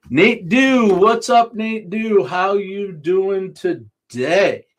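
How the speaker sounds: excited greeting. Real, not acted. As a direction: greet the viewer enthusiastically. Greets viewer in chat.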